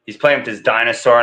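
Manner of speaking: ordinary delivery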